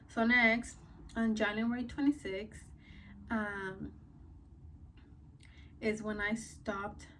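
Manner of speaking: ordinary talk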